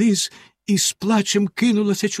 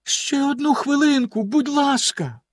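much higher, begging; pleading; 12 semitones higher than usual. A narrator's reading. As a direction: plead for one more minute. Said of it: "попросила його дружина".

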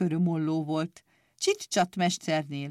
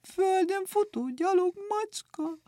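very high, whiny frightened cat; dismayed cat; 12 semitones higher than usual. Acted. Cat sliding down the tree, wailing in a very high whine. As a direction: wail like a cat who lost her claws. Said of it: "rémült képpel".

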